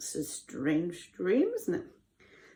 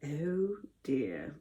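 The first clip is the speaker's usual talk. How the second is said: low, soft, sorrowful sigh of words; sad; 5 semitones lower, 9 dB quieter than usual. A narrator's reading. Joseph hears the baker's doomed dream, sad. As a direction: say it gently and sadly. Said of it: "said Joseph sadly".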